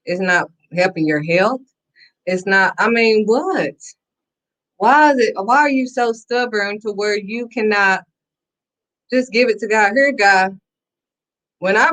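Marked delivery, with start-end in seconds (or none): none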